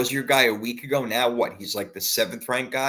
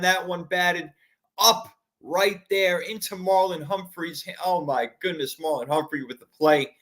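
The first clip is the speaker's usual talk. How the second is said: higher, worked-up game call; excited play-by-play; 7 semitones higher than usual. Acted. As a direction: keep calling it, still rattled. Still calling play after the pick.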